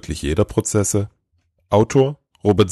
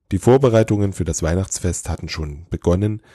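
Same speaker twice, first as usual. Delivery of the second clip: plain reading voice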